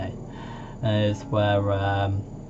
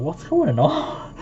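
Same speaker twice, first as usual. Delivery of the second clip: puzzled thought; 8 semitones higher, slower than usual. Retold or imitated. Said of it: "I was thinking".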